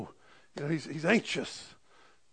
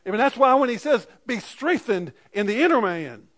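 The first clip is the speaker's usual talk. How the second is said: loud, raised-pitch sermon delivery; fervent preaching; 8 semitones higher, 13 dB louder than usual. Real, not acted. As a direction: preach it out with full force. Preaches at full force.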